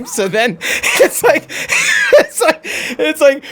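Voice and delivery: squealing, high laughter breaking the words; laughing. Real, not acted. Cracking up so hard he can barely talk to his friend. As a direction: lose it completely while talking.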